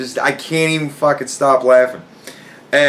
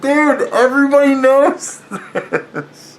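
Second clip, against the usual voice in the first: high, drawn-out, close to laughing; amused disbelief; 13 semitones higher, slower than usual. Retold or imitated. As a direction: stretch it out high, about to crack up. Mocks friend while cracking up.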